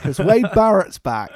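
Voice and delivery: announcer reveal. Acted. Announcing the returning commentator.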